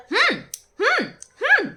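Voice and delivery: mocking "hm" noises, swooping up high; imitated humming grunts. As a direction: repeat short high hums, imitating someone. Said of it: "এরম করতে করতে". (নকল করে চড়া সুরে হুম হুম)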